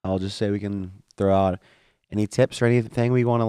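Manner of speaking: usual speaking voice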